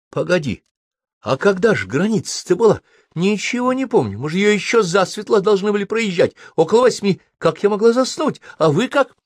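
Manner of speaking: high, flustered woman's voice; worried stewardess, rising alarm. Acted.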